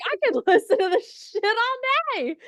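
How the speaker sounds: high, loud mock rapture with a laugh; joking self-admiration. Raving about her own voice, joking rapture. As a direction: gush loudly, hamming up the self-love. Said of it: "partially joking".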